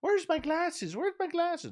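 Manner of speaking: high, fussy granny voice, searching for glasses; high fluttery mock granny; flustered old lady. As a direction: play a dithering grandma who's lost her glasses. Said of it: "she's like Thelma".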